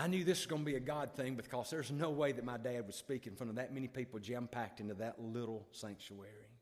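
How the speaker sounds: ordinary talk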